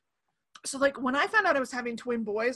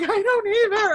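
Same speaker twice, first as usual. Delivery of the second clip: higher, louder helpless wail, acted; imitating upset daughter; 8 semitones higher, 6 dB louder than usual. Crying daughter answering her mom, helplessly upset. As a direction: mimic a child exasperated with herself.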